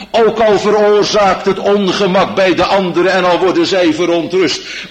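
loud, high pleading preaching; fervent preaching. Acted. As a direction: build to an urgent plea. Preaching at full force. (vurig en luid preken)

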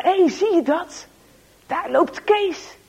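high, loud nudge to a mate; excited boys. Acted. A boy nudging his friends, excitedly. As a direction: point him out, eager and sly.

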